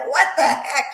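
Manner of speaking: gülerek şaşkın koca taklidi; laughing surprise. Retold or imitated. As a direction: hem gülüp hem şaşırarak söyle. Husband's retold surprised reaction.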